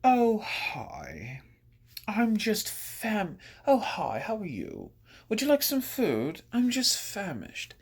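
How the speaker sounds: high, drawn-out mock Hollywood party voice; hammed-up affected small talk among the rich; affected rich socialite. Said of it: "in Hollywood".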